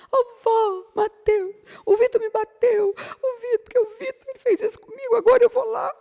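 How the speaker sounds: put-on high, crying child's voice; crying little boy. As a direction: whine it out like a sobbing five-year-old. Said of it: "Mateus foi lá chorando".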